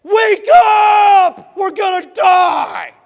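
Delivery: screaming wake up in a panic; panicked shout. Retold or imitated. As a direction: yell it like the boat is sinking. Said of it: "urgently were saying".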